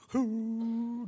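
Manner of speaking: high, drawn-out "hoo" of pretend weeping; hammed-up boo-hoo cry; mock sobbing wail. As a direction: imitate a self-pitying wail on one held note. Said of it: "crying, weeping".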